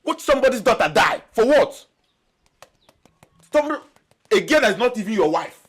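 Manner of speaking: loud, high-pitched appalled outburst; outraged shouting. Acted. Scolding friend who would watch Obi die, outraged shout. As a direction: shout in disbelief at the threat.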